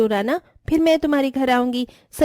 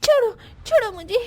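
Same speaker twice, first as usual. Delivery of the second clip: higher, crying out to be let go; frightened crying plea; 7 semitones higher than usual. Acted. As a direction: sob and beg to be released. Girl grabbed by witch. (रोते हुए डरकर छुड़ाने की गुहार)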